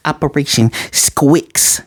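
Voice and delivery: "Squix" said with a kw in place of the tr of Strix; Anya's mispronunciation. Retold or imitated. Anya explaining her mission, "Squix" with kw instead of tr. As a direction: say "Operation Squix" slowly, kw sound instead of tr. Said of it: "Not Strix".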